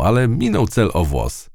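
plain reading voice

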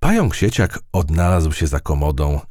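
plain reading voice

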